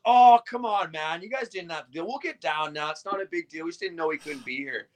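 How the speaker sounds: high, easy sweet-talk; smooth charming voice. Retold or imitated. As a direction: charm them into letting go. Rory charming his way out.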